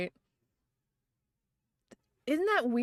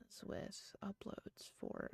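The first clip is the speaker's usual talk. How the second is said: whisper; 19 dB quieter, slower than usual. Real, not acted. Wondering aloud about anime uploads.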